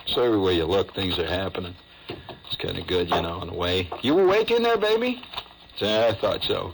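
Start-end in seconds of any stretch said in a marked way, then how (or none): 4.0-5.2 teasing call. Real, not acted. Teasing the audience or band.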